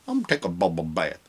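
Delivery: low, soft quoted cartoon line; a lightly voiced Big Mouth character; hint of a cartoon voice; imitating a cartoon character. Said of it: "she does the voice in Big Mouth".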